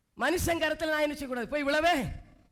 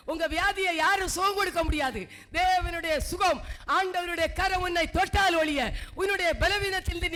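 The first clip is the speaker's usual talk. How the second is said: raised, driving sermon cadence; a preacher declaring that no one can stop God's healing; fervent preaching; 4 semitones higher than usual. Acted. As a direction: build the challenge line by line. Preaching climax on deliverance.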